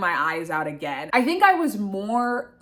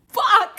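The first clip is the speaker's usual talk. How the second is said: frustrated laugh; 12 semitones higher, 6 dB louder than usual. Real, not acted. Enraged at being mocked.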